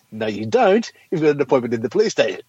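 normal talking voice

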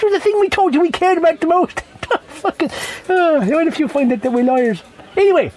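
high, put-on politician voice; mock Tory politician. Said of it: "The Tories, well, they don't".

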